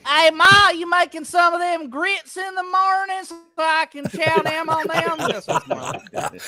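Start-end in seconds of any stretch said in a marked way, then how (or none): 0.1-5.2 higher, hammy southern voice; mock southern hillbilly. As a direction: play a country boy asking his mama for grits. Mock southern hick voice to mom.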